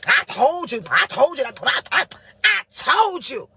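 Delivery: loud, high, gloating stutter; gloating I told you. Retold or imitated. Friend loudly gloating over your troubles. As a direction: rub it in hard. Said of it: "Not that, no".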